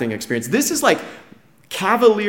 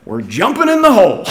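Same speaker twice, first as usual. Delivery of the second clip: higher, louder retold whoop of a plan; excited announcement; 5 semitones higher, 5 dB louder than usual. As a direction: imitate a gung-ho guide announcing the stunt. Guide gleefully announces a wild plan.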